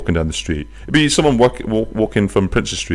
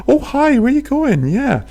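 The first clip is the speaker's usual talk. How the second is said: very high, camp sing-song greeting; camp bus; slower than usual. Acted. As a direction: play a camp bus cheerily greeting passengers. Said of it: "a little bit camp".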